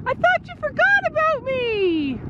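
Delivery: high, slow, pleading horse voice; voicing the horse. Owner voicing her horse running in, high and slow. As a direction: give the horse a needy little high voice, drawn out. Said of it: "He's like".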